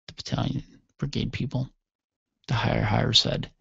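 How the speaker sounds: ordinary talk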